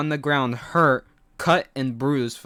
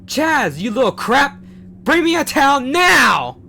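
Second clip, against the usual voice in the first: much higher and louder, raging; furious yell; 12 semitones higher, 11 dB louder than usual. A narrator's reading. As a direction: scream the order in fury. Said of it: "He yells again".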